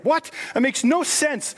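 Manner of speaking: high, slow incredulous "what?"; mock disbelief. Retelling his reply to his wife, in high, slow mock disbelief. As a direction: throw it up high in mock shock. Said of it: "I'm like".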